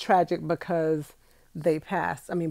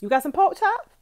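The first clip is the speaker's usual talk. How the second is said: excited; 12 semitones higher, 4 dB louder than usual. Real, not acted. Playful question.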